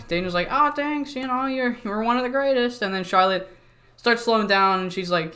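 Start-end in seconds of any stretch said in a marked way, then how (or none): 0.5-2.8 lightly raised pitch, mock humble; high mock-humble little Dana voice. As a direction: squeak out a modest thanks. Dana voiced in retold scene.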